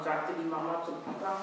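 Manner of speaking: ordinary delivery, nothing marked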